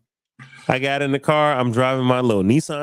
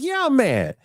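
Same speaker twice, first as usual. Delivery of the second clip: higher, heated mock retort; retold anger; 12 semitones higher than usual. [name] telling the woman he's mad, heated higher retort. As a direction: snap back, pitched well up. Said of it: "I said".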